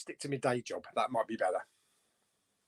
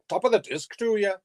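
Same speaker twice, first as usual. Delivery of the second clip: higher, put-on Irish lilt; Irish accent; 5 semitones higher than usual. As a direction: try a hammed-up Irish accent. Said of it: "like, an Irish accent".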